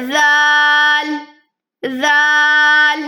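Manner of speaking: زال held long and slow, ending on ل; chanted زال; drawn-out letter name. Shown as a demonstration. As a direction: stretch زال evenly. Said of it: "زال، الف اور لام".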